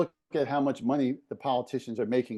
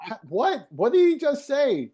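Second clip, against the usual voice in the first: surprised; 12 semitones higher, 6 dB louder, slower than usual. Retold or imitated. Friends react to hearing it.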